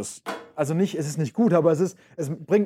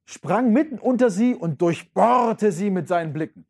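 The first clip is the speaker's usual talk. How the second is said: expressive literary reading voice; dramatic reading; 4 semitones higher, 3 dB louder than usual. Acted. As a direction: read it like a storyteller. Teacher reads Nietzsche aloud. (ausdrucksvoll vorgelesen)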